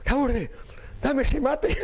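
high laughing order; high giggling request; laughing demand. Retold or imitated. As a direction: grab it while cracking up.

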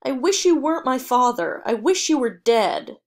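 loud, raw shouting; furious yell. A narrator's reading. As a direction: hurl it at him with hatred. Son yelling at his father.

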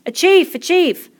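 high, loud crowd cries; rousing high-pitched shout; excited clamour. A narrator's reading. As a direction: call out as an eager crowd of boys. Boys clamour for a chief.

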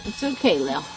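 calm a scared animal gently. Real, not acted. Soothing goat during teeth trimming.

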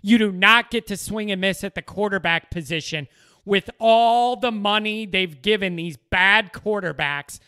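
ordinary delivery

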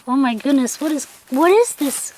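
normal talking voice

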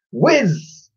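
very high, loud cry of alarm; whooping surprise; cry while flying. Acted. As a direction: yelp as he shoots skyward. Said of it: "he cried".

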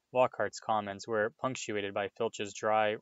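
ordinary delivery

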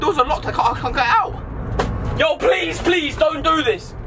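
high-pitched, distressed rambling; panicked pleading. Acted. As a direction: plead desperately as panic climbs. Panicking in locked van, pleading.

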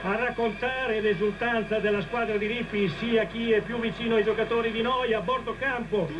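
ordinary talk

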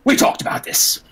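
a killer scolding calmly; menacing gunman. Acted. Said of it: "and he says".

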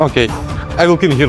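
ordinary talk

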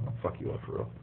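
dropped to a breathy, voiceless threatening hush; hushed threat. Real, not acted. Boyfriend threatening girlfriend about money, hushed menace. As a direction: lean in and hiss the threat low.

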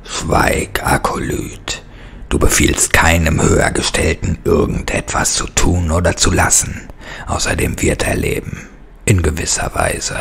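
unvoiced, cold hissed rebuke; menacing creature whisper. Bone master silences his acolyte in a menacing whisper.

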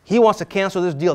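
ordinary talk, nothing marked

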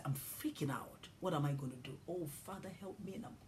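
soft, hushed frightened prayer; frightened whisper. Retold or imitated. Scared driver praying under breath, whispered. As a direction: whisper the plea to the Father. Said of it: "somebody will say".

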